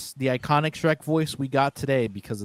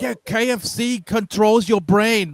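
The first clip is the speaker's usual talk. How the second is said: raised, slowed-down Scottish accent imitation; mock Scottish accent; 7 semitones higher, 4 dB louder, slower than usual. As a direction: imitate a comic Scottish dad, louder and higher. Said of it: "he was doing the Scottish accent".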